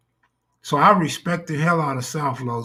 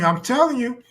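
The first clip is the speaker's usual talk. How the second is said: higher voice, pleading; 7 semitones higher than usual. Retold or imitated. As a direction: plead it in a high voice. Acting drunk woman pleading.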